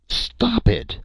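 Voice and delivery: very high-pitched cry of protest. A narrator's reading. The chief begs the fighters to stop, crying out. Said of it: "the chief cried".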